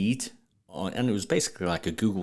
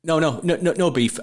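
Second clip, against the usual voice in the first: eager insistence; 9 semitones higher, 8 dB louder than usual. Real, not acted. Laughing insistence, no beef meant.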